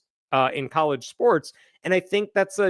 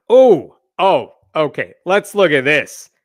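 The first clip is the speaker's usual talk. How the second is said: surprised outburst; 10 dB louder than usual. Real, not acted. Startled by a tweet on screen.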